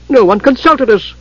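high-pitched indignant protest. A narrator's reading. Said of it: "Solinari protested".

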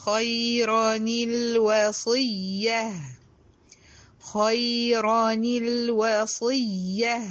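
خيرًا الوصية slowly as khayran-il-wasiyya; correct tajweed. Shown as a demonstration. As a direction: break the two sukuns with kasra on the nun. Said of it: "أنطقها إزاي؟". (خيرًا الوصية بكسر نون التنوين)

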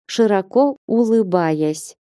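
ordinary delivery

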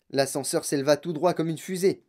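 plain reading voice

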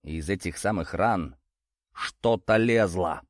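ordinary delivery

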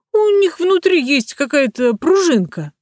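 high mocking put-on voice; mocking showman voice. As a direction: Peter parrots the carnival folk, taunting. Peter mimics fair showmen.

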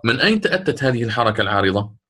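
usual speaking voice

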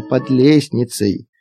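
ordinary delivery, nothing marked

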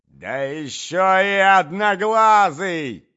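high, slow, very loud yell; shouted insult. A narrator's reading. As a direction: shout the jibe, stretching each word. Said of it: "закричала Марья Александровна".

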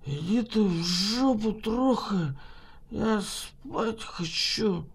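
put-on soft, slow sleepy drunk mumble; town drunk. A narrator's reading. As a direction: mumble it drunk, half asleep. Drunk doctor half asleep.

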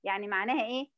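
usual speaking voice

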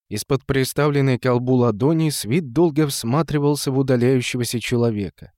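ordinary delivery, nothing marked

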